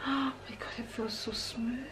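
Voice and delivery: soft, breathy whisper of delight; hushed gasp. Real, not acted. Touching the soft headband, whispered wonder.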